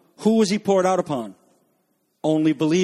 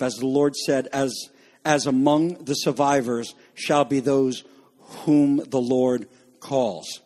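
scripture reading; 5 semitones lower than usual. Acted. Preacher reading Joel to the congregation, solemn and measured. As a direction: read the prophecy with weight.

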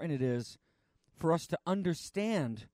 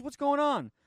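stagehand's loud demand; 12 semitones higher, 6 dB louder than usual. Retold or imitated. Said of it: "He says".

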